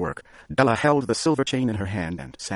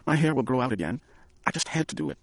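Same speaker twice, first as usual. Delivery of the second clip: anxious pleading; 7 semitones higher than usual. A narrator's reading. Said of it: "she cried".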